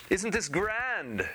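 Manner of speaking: very high, slow, gushing lady; high grand delight. Acted. Wife shows off her new castle, high and gushing.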